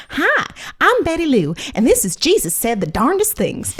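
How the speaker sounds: lightly voiced chirpy TV host introducing her show; religious talk show host. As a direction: open your own Sunday talk show, cheery and welcoming. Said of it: "like a religious talk show".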